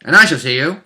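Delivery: normal talking voice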